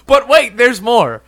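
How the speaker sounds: loud, high, slow sales pitch; infomercial pitchman. Acted. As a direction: announce it like a TV pitchman. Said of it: "infomercial guy".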